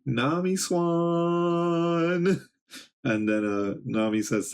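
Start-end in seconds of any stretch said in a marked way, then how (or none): 0.0-2.5 high, long drawn-out call of a name; lovestruck mock shout. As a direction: stretch the last word out in a high held call.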